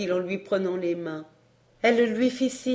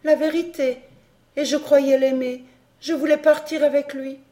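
higher and louder, raw anguish; despairing cry; 6 semitones higher, 5 dB louder than usual. Acted. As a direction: let the grief break out as she confesses. Camille confesses in despair.

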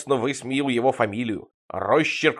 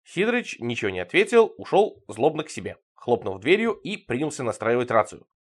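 plain reading voice

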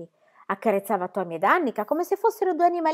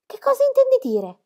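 much higher and louder, caught off guard; as if you can't believe your ears; startled question; 6 dB louder than usual. A narrator's reading. Tommy asking Pippi what she means, startled and high.

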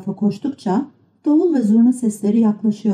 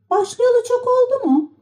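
very high-pitched little puppet voice; Pinokyo. A narrator's reading. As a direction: ask in a high childlike pitch. Pinokyo çocuğa soru soruyor. (tiz kukla çocuk sesi)

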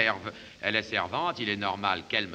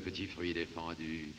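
low, much softer and seductive; seductive murmur; 5 semitones lower, 10 dB quieter than usual. Acted. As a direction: purr it close. Seductive aside.

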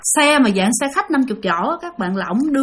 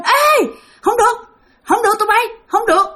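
ghost calling out; 10 semitones higher than usual. Retold or imitated. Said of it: "la lên".

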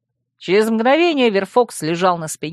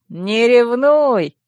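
teasing, higher and very drawn out; playful slow lilt, pitched up; cheerful; 5 semitones higher, 4 dB louder, much slower than usual. A narrator's reading. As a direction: sing it out merrily, stretching the vowels. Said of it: "весело отозвался Вемут".

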